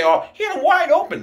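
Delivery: high, whiny fan voice; mock the fans who blame Mayfield; mocking quote of fans. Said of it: "People say".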